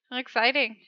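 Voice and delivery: ordinary delivery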